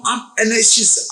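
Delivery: usual speaking voice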